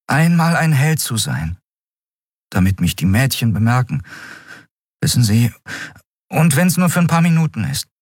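put-on boy confides his wish to be a hero; dreamy young Miko. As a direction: confess a daydream, a bit embarrassed.

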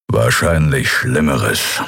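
low, slow grumbling growl. A narrator's reading. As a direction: growl a grim prediction slowly. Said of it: "knurrte Kero".

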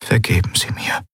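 breathy, voiceless plea; young officer whispering for forgiveness. Acted. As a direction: whisper the apology in shame. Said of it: "flüsterte er".

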